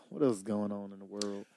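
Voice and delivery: ordinary delivery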